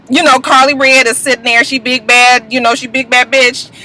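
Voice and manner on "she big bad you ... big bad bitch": usual speaking voice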